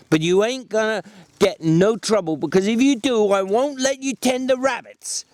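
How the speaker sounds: Lenny echoing George's warning back at him; Lenny mimicking George. A narrator's reading. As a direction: parrot the threat proudly.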